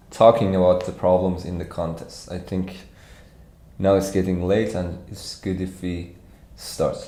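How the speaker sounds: ordinary talk, nothing marked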